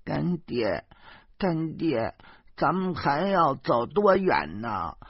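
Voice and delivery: put-on high child voice, worried and whiny; a small girl fretting on the steep climb; little girl voice. A narrator's reading. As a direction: play a tired, anxious little girl. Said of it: "她边走边说".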